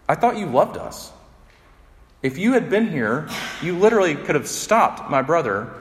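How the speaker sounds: hurt sister demanding why Jesus came late; angry Martha. Retold or imitated. Grieving Martha confronts Jesus for arriving too late, angry and high. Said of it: "I imagine Martha saying this angrily".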